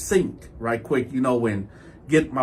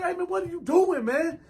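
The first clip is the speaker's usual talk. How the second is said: high, softer incredulous challenge; shocked protest; 12 semitones higher, 7 dB quieter than usual. Retold or imitated. Man confronting his naked bunkie, shocked.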